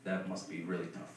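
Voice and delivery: quiet, low pitying murmur; hushed sympathy. Retold or imitated. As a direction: lean in and say it quietly. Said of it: "and he goes".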